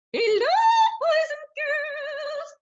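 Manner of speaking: high squeaky puppet voice, sung out slowly. Acted. Puppet Tessie greeting the boys and girls, high and sing-song. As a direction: Tessie the puppet singing hello.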